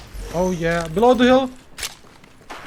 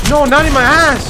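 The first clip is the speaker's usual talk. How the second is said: sudden loud yell; shouting; 8 semitones higher, 7 dB louder than usual. Real, not acted. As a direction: yell it out in alarm. Shouting at enemy push.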